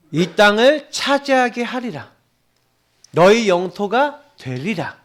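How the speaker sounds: slow, high, weighty promise; slow solemn proclamation. Real, not acted. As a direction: proclaim it slowly like a vow. Preacher proclaiming God's promise. (천천히 힘주어 선포하듯)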